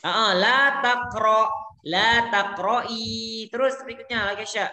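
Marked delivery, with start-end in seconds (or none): 0.0-3.5 la taqra then la taqra'i, the second drawn out slowly; slow model forms. Shown as a demonstration. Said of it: "teacher models both forms". (la taqra'i diucapkan pelan dan panjang)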